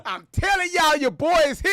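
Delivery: loud, high hype-man yell; hype intro shout. Acted. Streamer hyping his own entrance over sound effects, shouting. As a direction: announce yourself like a ring entrance. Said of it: "There go my sound effects".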